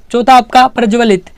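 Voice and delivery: ordinary talk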